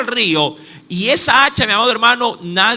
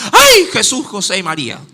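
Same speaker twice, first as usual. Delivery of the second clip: acted cry of sudden fright; startled exclamation; 3 dB louder than usual. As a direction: yelp it out, then let it fall. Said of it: "exclamación de un susto de algo inesperado".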